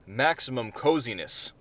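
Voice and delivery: usual speaking voice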